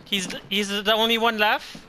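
loud urgent callout; excited shout. Real, not acted. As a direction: point out the enemy, loud and urgent. Spotting last enemy.

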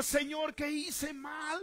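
high, loud, drawn-out cry; slow, shouted plea; anguished shout. Retold or imitated. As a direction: cry it out to heaven. Retold doubting cry to God.

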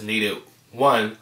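chewing between words; talking while eating. Real, not acted. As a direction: mouth full of food. Seen 2: Lifts food on fork into mouth, chews mid-sentence.

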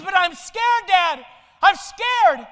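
put-on a small boy calling down to his dad, terrified; scared boy. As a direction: cry out in fear from the roof. Frightened boy on the roof.

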